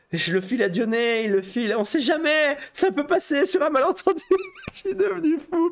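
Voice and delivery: high, breaking into laughter; giddy excitement. Retold or imitated. Dreaming of passing on a script, giddy excitement. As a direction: get carried away, laughing as the pitch climbs.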